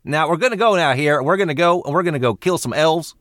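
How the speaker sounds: high-pitched Texan orc; rally the orcs in a put-on Texas twang; Texan accent. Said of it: "are they from West Texas".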